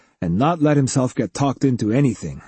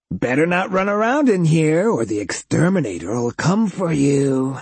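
taunting cyborg voice; 5 semitones higher than usual. A narrator's reading.

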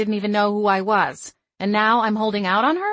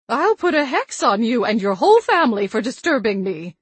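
put-on loud, higher threatening old woman; angry witch threat; 4 semitones higher, 7 dB louder than usual. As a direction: threaten a hex, loud and fierce. Grandmother threatens unseen intruder.

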